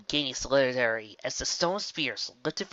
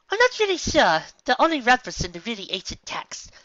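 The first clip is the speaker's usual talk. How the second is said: much higher, teasing character voice; lightly voiced sarcastic pony; 10 semitones higher, 5 dB louder than usual. A hint of a pony teasing the princess, higher and louder. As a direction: needle the princess with mock politeness. Character dialogue in read story.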